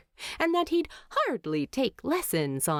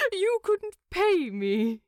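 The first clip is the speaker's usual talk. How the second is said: startled laugh; 12 semitones higher than usual. A narrator's reading. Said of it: "Bilbo laughed, a startled little sound".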